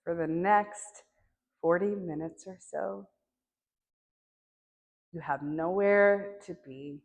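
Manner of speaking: unhurried meditation guide, long silences between phrases; guided meditation. Acted.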